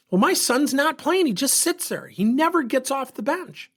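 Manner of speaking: put-on high, worked-up complaint about her child; angry mother. Angry mother complaining to coach about the bench, worked up. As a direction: imitate an indignant parent, pitch well up. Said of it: "She's angry".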